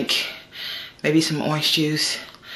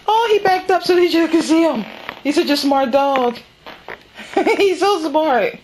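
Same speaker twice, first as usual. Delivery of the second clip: high, gushing praise for a clever dog; doting pet talk; 10 semitones higher than usual. Real, not acted. To her dog Biscuit, high doting pet talk. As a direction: coo over the dog like a proud owner.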